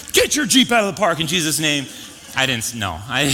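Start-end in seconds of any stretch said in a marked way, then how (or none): 0.1-1.9 higher, louder, hammed-up rebuke in Jesus' name; mock preacher command. Pastor pretending to rebuke the Jeep owner, hammed-up and loud. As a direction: boom it out like a mock exorcism. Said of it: "just full of the Holy Spirit".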